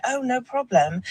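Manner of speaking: a touch of imitation British; British accent. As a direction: mimic a polite Brit, British accent lightly done. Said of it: "that was my British accent".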